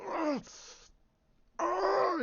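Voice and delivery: hammed-up wounded scream; mock scream. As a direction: howl up in pain. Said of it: "he's screaming up at Chewie".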